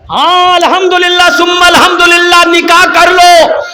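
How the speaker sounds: high, loud preacher's cry; fiery preaching. Acted. Preacher roaring at the young men. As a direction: thunder it to the crowd.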